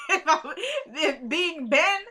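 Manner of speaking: real laugh breaking into the words. Real, not acted. Amused at own hypothetical advice.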